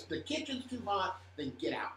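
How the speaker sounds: normal talking voice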